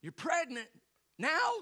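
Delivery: mock-astonished pastor; surprised pastor. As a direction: imitate the old pastor's startled question. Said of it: "he looks at us and he goes".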